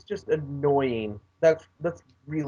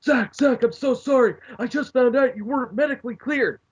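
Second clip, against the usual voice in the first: high, fast fake-sorry voice; a boss pretending to be sorry, hammed up; mock apology; 8 semitones higher, 3 dB louder than usual. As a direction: ham up a phony apology. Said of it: "comes in and goes".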